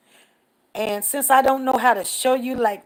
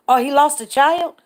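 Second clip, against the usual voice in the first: surprised; 5 semitones higher, 10 dB louder than usual. Real, not acted. Reacting to a viewer's news.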